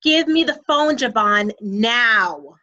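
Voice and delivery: stern mom. Acted. Mom confiscating her son's phone at dinner, stern. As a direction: order it like a mother out of patience. Mother demands the ringing phone.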